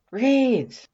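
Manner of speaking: a high, loud call from another room. Acted. Undyne calling for Reeds through the house, loud. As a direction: call the name across the house.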